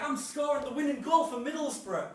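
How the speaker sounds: mock match excitement; pretend commentary. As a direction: boast it like a winning goal. Child pretending to score the winner.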